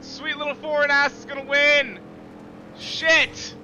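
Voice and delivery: high loud cry of defeat; real outraged yelling; anguished shout. Real, not acted. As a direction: scream it as the win is lost. Rival wins the race.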